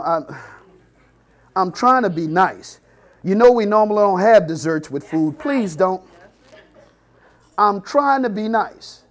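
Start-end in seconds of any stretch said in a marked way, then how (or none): 0.0-2.8 slow, tight, strained patience; forced politeness. Retold or imitated. 5.4-9.0 slow, tight, strained patience; forced politeness. Retold or imitated. Mother repeats, strained.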